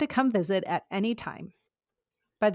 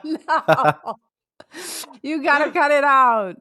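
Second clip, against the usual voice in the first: laughing through tears; 7 semitones higher, 9 dB louder, slower than usual. Real, not acted. Laughing-crying plea to cut.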